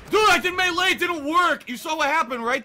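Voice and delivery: high, very loud protest; loud indignant gamer rant; outraged shouting. Real, not acted. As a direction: shout your case in real frustration. Protests game glitch.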